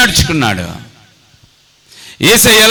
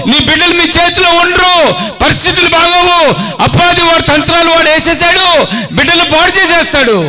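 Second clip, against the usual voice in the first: preaching at full force; 6 semitones higher than usual. Acted. Preacher rebuking parents, full-force shouting. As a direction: thunder it at the congregation.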